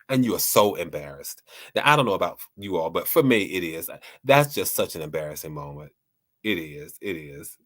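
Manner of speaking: usual speaking voice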